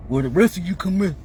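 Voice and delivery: lightly put-on caricature of others' speech; mocking how people talk. Speaker showing how people expect her to talk, mimicking. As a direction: imitate their way of talking, with swooping pitch. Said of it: "Why do people talk like this?".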